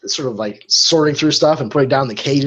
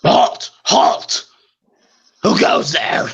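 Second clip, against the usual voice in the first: guard shout; 6 semitones higher, much slower than usual. Acted. Gate guard challenges party.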